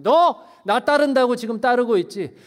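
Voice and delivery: loud, high, demanding challenge; preacher booming Jesus' words; Jesus confronting the crowd. Acted. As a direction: call out the crowd's easy promise. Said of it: "오늘 예수님이 우리에게 말씀하세요".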